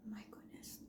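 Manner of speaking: whispered in delight; awed whisper. Real, not acted. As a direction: breathe it out, amazed. Tasting the food, whispered.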